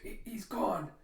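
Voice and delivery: high, stammering, scared; frightened stammer. Acted. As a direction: squeak it out while pinned. Man held by the neck.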